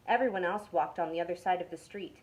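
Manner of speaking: ordinary delivery, nothing marked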